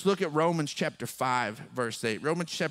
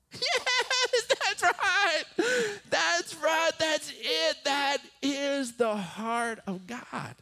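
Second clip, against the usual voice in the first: high, elated praise; joyful exclamation; 12 semitones higher, slower than usual. Retold or imitated. Father overjoyed as his son hugs him. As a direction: burst out in delighted approval. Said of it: "And I'm just like".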